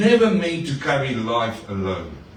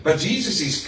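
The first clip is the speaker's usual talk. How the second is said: ordinary delivery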